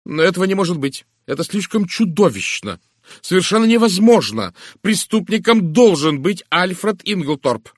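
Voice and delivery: high, loud, insistent denial; horrified protest. A narrator's reading. Miss Howard rejects her own terrible suspicion, horrified protest. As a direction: protest in horror, hitting words hard.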